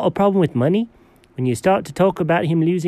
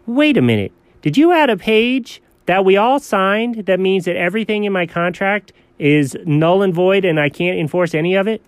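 usual speaking voice

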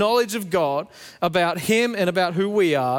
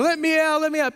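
put-on high, playful child's plea; imitating a child; 10 semitones higher than usual. Son begging from the cupboard, high childish plea. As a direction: squeak it out like a giggling kid.

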